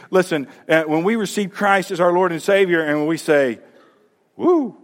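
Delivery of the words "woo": a high, weak little woo; half-hearted woo. Retold or imitated. Believer greeting good news with a limp woo. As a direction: cheer without meaning it. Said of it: "the excitement doesn't match the news".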